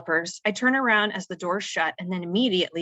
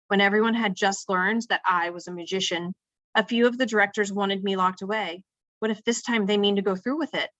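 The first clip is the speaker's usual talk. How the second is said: ordinary delivery, nothing marked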